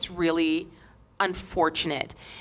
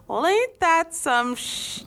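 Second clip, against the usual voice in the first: sarcastic drawl; 8 semitones higher, slower than usual. Retold or imitated. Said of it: "says every native in America".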